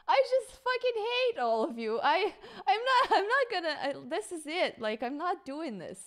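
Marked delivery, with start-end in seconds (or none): 0.1-3.8 exasperated laughing outburst. Real, not acted.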